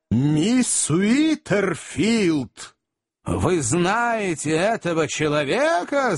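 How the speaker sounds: high, very slow summons; jealous accuser, stretched words; stern interrogation. A narrator's reading. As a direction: draw out the name, then question accusingly. Magnus interrogates the lady.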